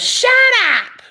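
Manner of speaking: loud mock witch yell; imitated yell. Woman silencing me about the room, imitated yell. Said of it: "she yelled at me".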